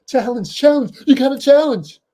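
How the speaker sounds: high, loud, insistent yelling; hammed-up player pestering his coach; mock frantic demand. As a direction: imitate an urgent, shouted plea. Acts out player demanding a challenge.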